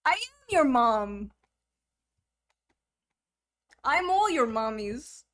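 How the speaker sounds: ordinary delivery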